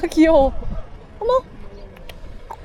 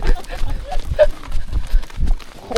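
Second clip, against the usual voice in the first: squealing laugh. Real, not acted. Laughing while riding.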